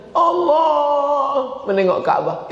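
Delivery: high, slow, awed sigh; slow, high mock awe; mock awed pilgrim. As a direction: act the pilgrim stunned at the Kaaba. Imitating pilgrims seeing Kaaba.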